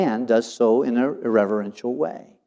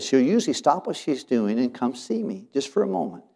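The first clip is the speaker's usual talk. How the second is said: ordinary talk, nothing marked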